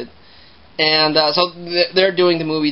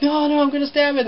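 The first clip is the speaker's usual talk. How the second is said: higher, frantic mock-hysterical parent; panicky parents; 10 semitones higher than usual. Parents answering the bear, frantic mock panic. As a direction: imitate a freaked-out parent lunging. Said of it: "And they're like".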